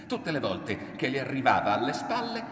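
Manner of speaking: plain reading voice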